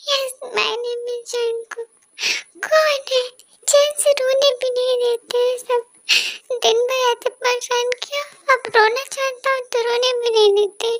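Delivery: hurt schoolboy sobbing to himself; lightly voiced crying boy. Jungkook answers while crying.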